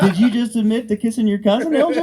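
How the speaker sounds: high, shouted appalled question; hammed-up shock, yelling at the cousin; loud disbelief. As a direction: call them out, loud and incredulous. Retold shock at cousin's confession.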